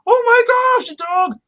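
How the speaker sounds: very high, loud shocked squeal; shocked gasp. Retold or imitated. Harry turning to see the dog, high shocked squeal. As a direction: shriek it in sudden alarm.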